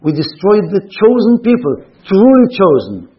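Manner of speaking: formal reading. Acted. Rabbi reading a Spaniard's letter to his congregation, declaiming loudly. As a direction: read it like a proclamation to the congregation. Reads a quoted paragraph.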